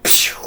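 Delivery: sharp hissing snap noise; snap sound effect. Retold or imitated. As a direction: spit out a hissing crunch. Robot jaw snaps shut.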